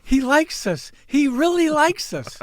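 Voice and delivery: high-pitched, playful fake delight; hammed-up squeal of being liked; mock gushing. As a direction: gush like a thrilled award winner. Mock gushing over guest's praise.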